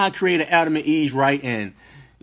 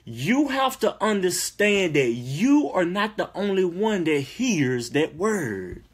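ordinary delivery

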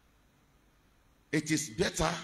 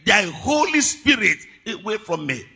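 high, loud, impassioned sermon; full-force preaching; 6 semitones higher, 9 dB louder than usual. Acted. Preacher voicing David's plea to God, full-force preaching. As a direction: cry the plea out to the congregation.

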